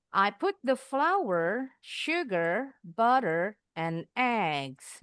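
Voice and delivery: slow list, "flour", "sugar", "butter" each rising, "eggs" falling; rising list intonation. Shown as a demonstration. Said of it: "jadi intonasinya perlu diperhatikan". ("flour", "sugar", "butter" naik, "eggs" turun)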